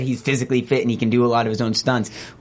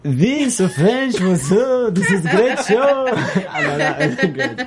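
slow, higher, hammed-up French accent; 9 semitones higher, slower than usual. Asked by the cohost, a slow mock French accent. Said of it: "Do a French one".

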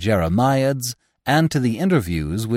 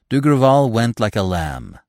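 usual speaking voice

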